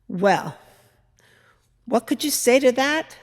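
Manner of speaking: ordinary delivery, nothing marked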